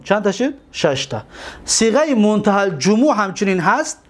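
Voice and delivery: ordinary talk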